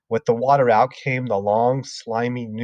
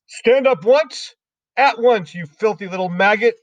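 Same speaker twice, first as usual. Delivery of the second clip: much higher, louder furious order; angry yell; 10 semitones higher, 5 dB louder than usual. Acted. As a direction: bark it at the child. Headmistress yelling at pupil.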